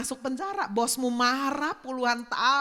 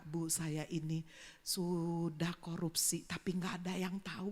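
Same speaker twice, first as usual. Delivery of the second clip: whispered confession, almost no voice; imitating a congregant's whispered confession; hushed confession; 7 semitones lower, 8 dB quieter than usual. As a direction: tell the secret in a hush, as if someone might overhear. Congregant confessing secret to pastor.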